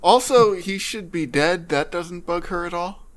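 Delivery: ordinary talk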